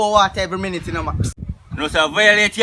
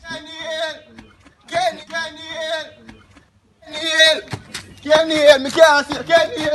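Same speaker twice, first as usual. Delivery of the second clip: calling out; 7 semitones higher than usual. Acted. Calling out for Kenny.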